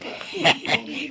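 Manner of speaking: short breathy chuckle; soft laugh. Acted. Husband chuckling at his wife, soft laugh. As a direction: let a quiet laugh slip out. Husband amused by wife's talk.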